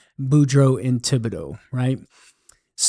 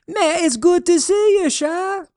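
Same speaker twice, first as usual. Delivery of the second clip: Cajun holler back; 14 semitones higher than usual. Acted. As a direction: holler a cheery Cajun hello from the rice field. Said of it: "Thibodeau hollers back".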